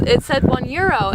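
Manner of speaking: ordinary talk, nothing marked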